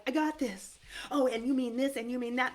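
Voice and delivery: an upbeat, mock-triumphant "I got this"; eager confidence. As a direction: play the brain cheerfully catching on.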